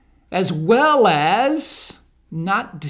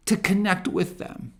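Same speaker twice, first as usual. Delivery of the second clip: ordinary talk, nothing marked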